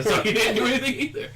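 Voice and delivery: high, amused telling through giggles; laughing. Real, not acted. Telling how the brother didn't help either, laughing. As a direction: laugh your way through the sentence.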